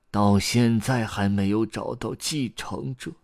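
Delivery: grandpa's rising, weepy lament; breaking into tears. A narrator's reading. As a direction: wail it as you start to cry. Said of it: "故事爷爷嚎啕大哭了起来".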